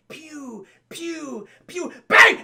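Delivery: pews rising to a loud bang; gunshot noises building to bang. Retold or imitated. Broadway firing her gun by accident, pew pew then a loud bang. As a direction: build the shots to a big bang.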